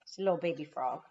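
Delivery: ordinary delivery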